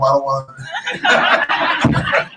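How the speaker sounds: stretched out slowly through a laugh. Real, not acted. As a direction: laugh it off coyly. Laughing off saying more.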